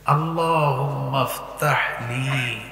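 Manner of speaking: slow, deliberate phrase-by-phrase dua; attentive recitation. Shown as a demonstration. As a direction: dwell on each phrase as you translate. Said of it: "খেয়াল করো". (মনোযোগ দিয়ে ধীরে পাঠ)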